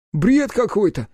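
usual speaking voice

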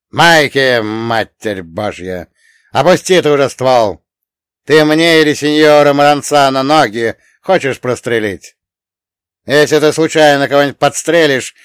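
exasperated boss roaring threats and rebukes; furious bark. A narrator's reading. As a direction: bark at a careless underling. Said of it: "рявкнул, прервав диалог".

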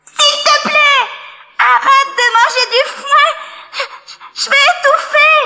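put-on high, pleading little boy; beg, high and tearful; tiny voice crying out. A narrator's reading. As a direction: Tom Pouce pleads from the cow's stomach, high and crying. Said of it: "une petite voix qui criait".

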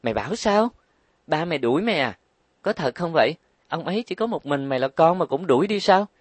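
high, shocked questions; can't believe what she hears. A narrator's reading. Trang Đài hearing Linh was thrown out, shocked. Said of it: "Trang Đài lộ vẻ kinh ngạc".

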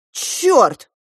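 high, loud burst; exclaimed curse. A narrator's reading. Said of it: "воскликнул папа". (громкое восклицание)

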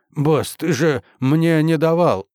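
high, slow bleating whine. A narrator's reading. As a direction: whine it like a scared sheep. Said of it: "проблеял он".

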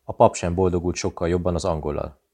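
ordinary delivery, nothing marked

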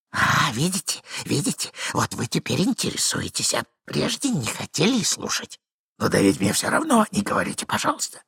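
lightly voiced agitated gentleman; flustered man. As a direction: reproach him, flustered. Plays flustered hidden Ivan.

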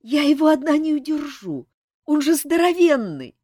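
high, loud desperate pleading; desperate plea. A narrator's reading. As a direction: cry out that he's too big. Azil pleading loudly.

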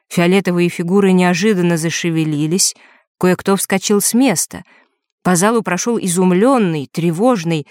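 usual speaking voice

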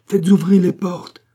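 loud, high-pitched command; cried out. A narrator's reading. King orders the palace doors opened, crying out. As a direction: call the order out urgently. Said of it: "s'écria le roi".

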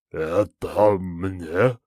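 slow, bubbling monster voice; gurgling voice. A narrator's reading. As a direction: gurgle it like a jelly creature. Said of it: "булькающим голосом спросил он".

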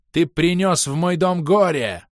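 high, loud, drawn-out accusation; furious yell. A narrator's reading. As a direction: bellow slowly in accusation. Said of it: "заорал Снежный".

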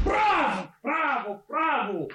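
high first cry, each one falling; delighted bravo. Acted. Director applauding his actors, delighted cries of bravo. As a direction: cheer them on, starting high.

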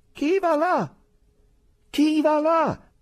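loud, high, slow second call; sentry repeats challenge. Acted. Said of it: "ripeté la sentinella".

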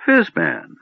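put-on high, pleased old man's confirmation; smiling recognition. A narrator's reading. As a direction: seize on the name with a smile. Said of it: "the old man nodded, smiling".

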